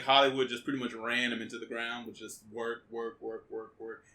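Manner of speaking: ordinary talk, nothing marked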